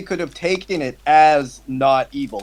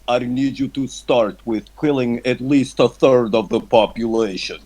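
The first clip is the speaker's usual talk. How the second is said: mock sinister Kissinger; 6 semitones lower than usual. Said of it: "a guy comes in that looks like him that says".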